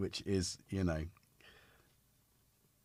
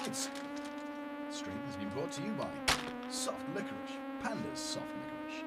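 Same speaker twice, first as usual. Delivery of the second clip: mock sponsor read. Host announces the stream sponsor in a mock voice.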